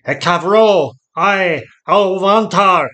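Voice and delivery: loud, high incantation; ringing, full-force spell; casting a spell. A narrator's reading. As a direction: cry out the magic words, drawn out. Lord casts spell of changing.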